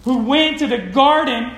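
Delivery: ordinary talk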